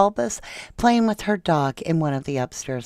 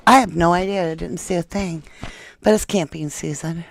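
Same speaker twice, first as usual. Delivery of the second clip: ordinary delivery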